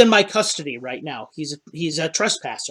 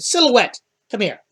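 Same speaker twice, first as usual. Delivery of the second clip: calling out; 6 semitones higher than usual. Acted. Captain calls his shadow cat.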